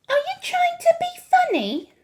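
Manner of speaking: high, loud, prim indignation; a prim woman's high-pitched challenge. A narrator's reading. Mrs. Jenkins challenges the grandmother, primly. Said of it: "very prim".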